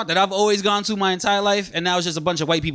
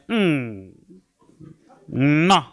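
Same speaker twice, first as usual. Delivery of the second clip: French disapproval; 4 semitones lower than usual. Acted. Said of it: "when French people don't like your jokes".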